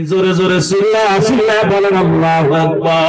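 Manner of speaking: rally the crowd into the takbir; rousing congregational call. Real, not acted. Urging congregation to shout takbir. (জোরালো তাকবিরের হাঁক)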